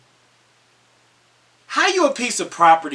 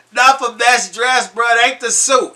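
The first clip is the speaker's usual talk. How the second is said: emphatic; 7 semitones higher, 7 dB louder than usual. Acted. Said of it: "repeated line".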